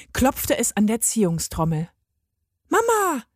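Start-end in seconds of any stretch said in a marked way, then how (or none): 2.7-3.2 put-on high, ringing child's call; calling Mama. A narrator's reading. Lottery hostess's daughter calling to her mother, high call. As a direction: call out to mum from outside. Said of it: "rief die Tochter".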